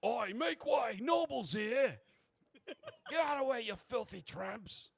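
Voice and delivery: loud, high holler to clear the way; rough street-crier's yell; shouting doorman. Acted.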